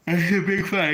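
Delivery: talking through a yawn; words stretched by a yawn. Real, not acted. Boxing fan to viewers, mid-yawn. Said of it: "excuse me for yawning".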